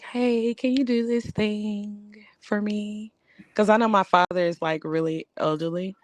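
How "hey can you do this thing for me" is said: higher, slow and soft request; real soft. Shown as a demonstration. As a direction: ask sweetly and slowly. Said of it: "you gotta say it real soft".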